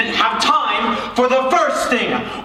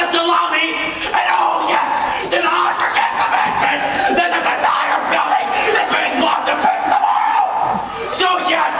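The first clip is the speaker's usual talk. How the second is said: rising, shouted exasperated tirade; angry rant; 5 semitones higher than usual. Acted. Director explodes at the demanding star, shouting. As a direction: blow up at the diva.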